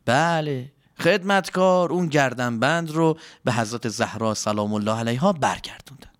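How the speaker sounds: ordinary delivery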